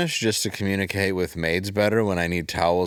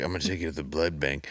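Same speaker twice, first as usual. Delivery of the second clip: Steven Seagal impression; 6 semitones lower, 7 dB quieter, faster than usual. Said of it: "it's like".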